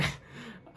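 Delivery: soft unvoiced laugh; a quiet airy chuckle; breathy laugh. Real, not acted. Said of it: "I started laughing".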